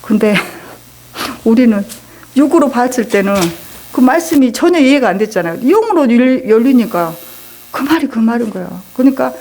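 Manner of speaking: ordinary delivery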